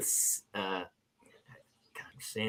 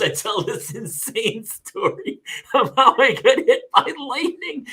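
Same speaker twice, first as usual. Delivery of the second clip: higher, louder, slowed by laughter; laughing while talking; 7 semitones higher, 6 dB louder than usual. Real, not acted. Admitting the lightning story was invented, laughing. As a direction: crack up while confessing the tall tale. Cracking up at own tall tale.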